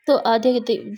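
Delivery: ordinary delivery, nothing marked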